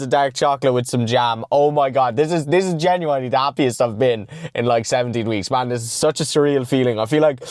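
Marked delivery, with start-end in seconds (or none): none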